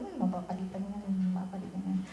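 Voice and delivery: soft, low-key murmur to herself; a quiet, resigned reassurance; hushed murmur. Retold or imitated. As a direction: murmur it under your breath, shrugging it off. Calmly telling herself it's fine.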